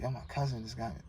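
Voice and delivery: retold in a quiet, quick murmur; hushed, fast, shaken; low undertone. Cousin on the phone delivering the news, hushed.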